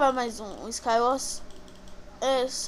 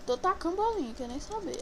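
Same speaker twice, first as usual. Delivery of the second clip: ordinary delivery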